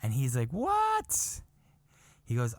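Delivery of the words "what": squeaky high shocked what; stunned what. Retold or imitated. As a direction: shoot up in disbelief. Buddy's stunned reply.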